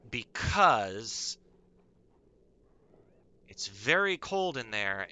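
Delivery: ordinary talk, nothing marked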